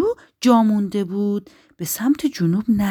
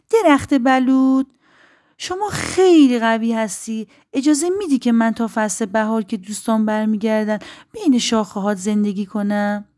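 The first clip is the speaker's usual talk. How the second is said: higher, louder little-bird voice; pleading little bird; 4 semitones higher, 6 dB louder than usual. Acted. Little bird begs the oak for shelter, pleading. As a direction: call up to the oak with a hopeful plea.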